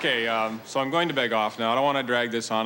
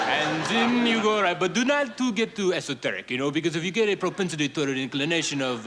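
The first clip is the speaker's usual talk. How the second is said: put-on French accent running away into fluent chatter; fake French accent; 3 semitones higher than usual. Bogus Frenchman showing off to a tourist, chattering French accent. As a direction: babble on in a mock French accent. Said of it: "I'm just a poor Frenchman".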